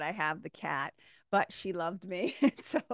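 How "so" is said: laughing. Real, not acted. Laughing about landlord.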